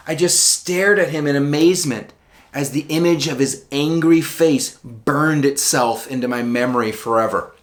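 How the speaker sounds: usual speaking voice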